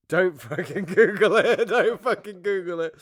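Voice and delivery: high, laughing don't-Google-it; laughing plea. Real, not acted. Laughing, begging her cohost not to google it. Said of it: "laughing plea to cohost".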